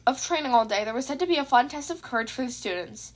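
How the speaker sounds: plain reading voice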